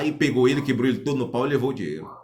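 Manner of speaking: ordinary delivery, nothing marked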